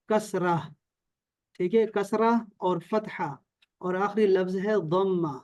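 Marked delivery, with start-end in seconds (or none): none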